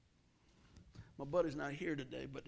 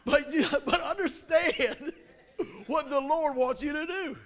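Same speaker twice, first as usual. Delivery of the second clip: loud and much higher, laughing through it; booming laughing preacher's call; laughing shout; 10 semitones higher, 14 dB louder, slower than usual. Real, not acted. Preacher laughing at own stumble.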